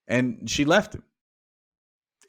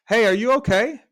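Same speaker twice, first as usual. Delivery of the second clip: much higher and louder, a shout down into the hole; calling out; 10 semitones higher, 6 dB louder than usual. Acted. Chanel shouts down to the stuck man, high loud call. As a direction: shout to reach someone far below.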